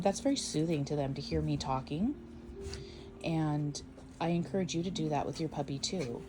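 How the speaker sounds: usual speaking voice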